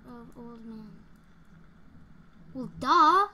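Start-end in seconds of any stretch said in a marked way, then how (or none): 2.5-3.3 loud sarcastic duh. Real, not acted. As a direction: scoff it out loud. Mocking obvious answer.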